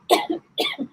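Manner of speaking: rough sore-throat cough; harsh cough. Real, not acted. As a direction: cough hard, then recover. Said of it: "I've got a bit of sore throat".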